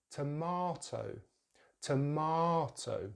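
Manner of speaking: slow "tomato" as tuh-MAH-toh; "tomato" with drawn-out ah; British pronunciation. Shown as a demonstration. Teacher models British English, slow "tomato" with long ah. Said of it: "So in British English".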